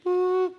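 high, held electronic-style boop; imitated test beep. Preacher mimics headphone tone in hearing test, high beep. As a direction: sound a single high, level tone. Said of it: "and you hear".

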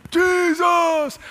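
high, long-drawn calling; sustained high cry for mercy; lifted-up voices. Acted. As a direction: call out from far off, holding each word. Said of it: "they lifted up their voices".

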